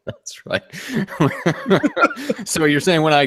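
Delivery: laughing through the words; cracking up at the bit. Real, not acted. Cohost laughing at the cheesy sales pitch.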